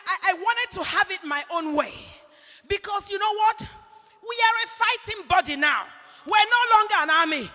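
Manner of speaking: loud, fervent sermon delivery; preaching. Acted. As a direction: preach it with full conviction to the congregation. Preaching sermon at full force.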